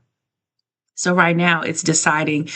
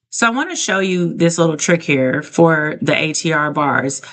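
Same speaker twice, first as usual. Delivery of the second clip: normal talking voice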